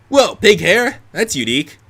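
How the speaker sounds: high-pitched start in a put-on British accent. Man calling out the newcomer's hair, British accent. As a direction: exclaim it as a British character. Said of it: "in a thick British accent".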